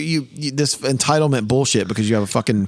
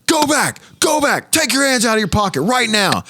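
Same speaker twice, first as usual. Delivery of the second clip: barking TSA agent; 9 semitones higher than usual. Retold or imitated. Imitating the TSA guard yelling at his five-year-old son. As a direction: bark orders like a bossy security guard. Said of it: "the guy goes".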